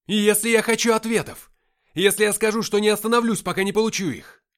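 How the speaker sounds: high, loud, defiant demand; furious shout. A narrator's reading. Narrator demanding answers from Deimos, furious shout. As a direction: keep shouting, rage barely held in. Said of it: "выкрикнул я, сдерживая бушующую в груди ярость".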